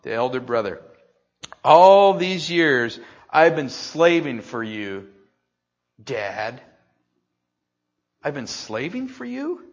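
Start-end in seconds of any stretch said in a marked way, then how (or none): 1.6-6.6 loud, slow, bitter complaint; a touch of an aggrieved older son; resentful elder brother. Acted. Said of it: "listen to his heart as he's talking". 8.2-9.7 incredulous echo. Acted. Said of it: "preacher echoes in disbelief".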